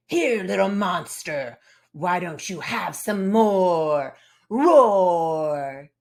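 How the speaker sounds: loud snarling monster ending in a roar. Acted. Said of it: "They'd probably snarl, and they definitely roar".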